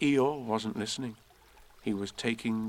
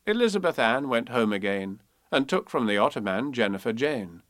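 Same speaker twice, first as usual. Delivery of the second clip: ordinary delivery, nothing marked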